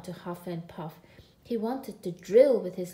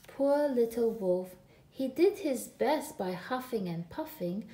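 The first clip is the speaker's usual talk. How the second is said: usual speaking voice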